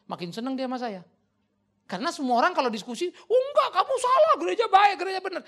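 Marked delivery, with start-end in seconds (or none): 3.3-5.4 loud, very high frantic denial; indignant mock protest. Preacher mimics people defending the church, mock indignation. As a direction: parody someone defending in outrage.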